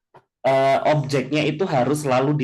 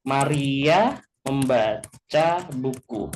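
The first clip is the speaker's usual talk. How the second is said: slow, deliberate reading of the example; teacher contrasting word order slowly; slow example sentence; much slower than usual. Shown as a demonstration. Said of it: "Kalau di bahasa Indonesia kan".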